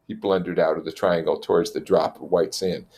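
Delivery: ordinary delivery, nothing marked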